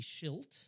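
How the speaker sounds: "shilt" said as one syllable, sh- onto -ilt; blended word. Shown as a demonstration. Showing the co-host the slip, "shilt" as a blend. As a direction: blend shame and guilt into "shilt". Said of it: "take the beginning of shame and the end of guilt".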